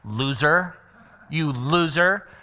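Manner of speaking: mock-teen contempt; slow, dismissive teen put-down; teenage attitude. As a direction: drawl the insult like a scornful teenager. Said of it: "it's the attitude".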